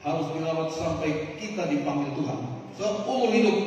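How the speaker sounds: loud, driving sermon voice; fiery preaching. Acted. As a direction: hammer the point home to the congregation. Preaching at full force.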